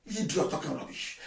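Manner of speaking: hushed muttered insult; half-whispered aside; under the breath; scornful whisper. Real, not acted.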